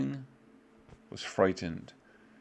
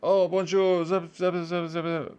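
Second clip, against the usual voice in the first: higher and louder, mock French babble; fake French gibberish; 8 semitones higher, 4 dB louder than usual. Teacher pretending to speak French to class, mock gibberish. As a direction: babble nonsense in a put-on French voice. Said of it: "If I came into class and started speaking French".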